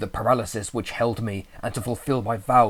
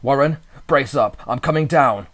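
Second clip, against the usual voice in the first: louder, firm shout; shouted resolve; 5 semitones higher, 9 dB louder than usual. A narrator's reading. Narrator shouting down the phone to his friend, resolute. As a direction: call down with resolve. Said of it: "I formed and shouted a resolution".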